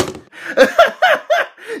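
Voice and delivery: high shrieking laugh of disbelief; disbelieving laugh. Real, not acted. Reviewer cracking up at the ugly toy, high shrieking laugh. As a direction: laugh at how awful it is.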